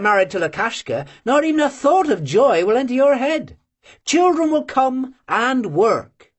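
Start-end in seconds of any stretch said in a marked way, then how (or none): none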